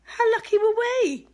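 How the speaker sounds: excited. Real, not acted. Marvelling at the gifts.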